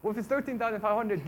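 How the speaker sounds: normal talking voice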